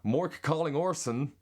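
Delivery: high-pitched, slowish American accent. Shown as a demonstration.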